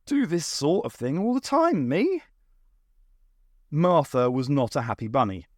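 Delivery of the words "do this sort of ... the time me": breezy brag, lifting high at the end; winking, lilting self-assurance; confident boast. A narrator's reading. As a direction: toss it off cockily. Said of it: "said the doctor with a confident wink".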